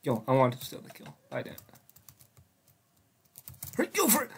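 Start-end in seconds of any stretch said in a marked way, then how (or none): 3.8-4.3 raging gamer. Real, not acted.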